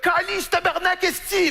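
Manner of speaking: high, eager put-on voice; imitated pleading fan. Parisian begging to be sworn at in Québécois, high and eager. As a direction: draw out the swearwords in a high voice. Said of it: "Ben, dit, «Dites-moi, là".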